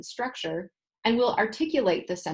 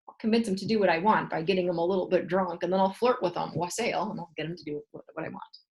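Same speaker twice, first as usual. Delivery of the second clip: usual speaking voice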